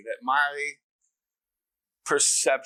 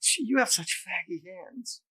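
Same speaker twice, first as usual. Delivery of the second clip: slow, higher mock mom voice; voiced mother; 5 semitones higher, 3 dB quieter than usual. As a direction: lightly imitate his mother, drawing it out. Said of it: "in her, like, loop".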